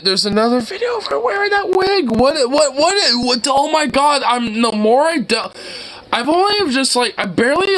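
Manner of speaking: high-pitched incredulous outburst; flabbergasted. Real, not acted. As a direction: stammer in rising disbelief. Shocked by another strange video.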